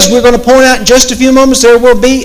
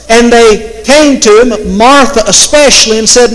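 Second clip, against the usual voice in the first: ordinary delivery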